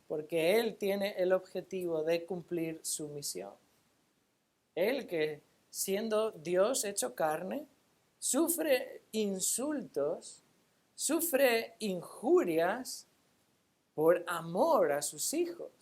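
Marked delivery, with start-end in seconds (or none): 4.7-15.7 high, drawn-out pulpit cadence; impassioned preaching. Real, not acted. Preacher telling believers of Christ's suffering, impassioned preaching. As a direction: stretch each phrase, pitch swooping upward.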